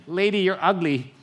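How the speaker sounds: a lightly voiced rude parrot; cheeky parrot voice. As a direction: squawk the insult like a smug pet-shop parrot. Parrot insults woman again.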